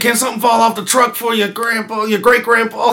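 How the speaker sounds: shouted pleading street slang; pleading street-guy voice. Retold or imitated.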